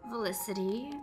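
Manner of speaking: ordinary talk, nothing marked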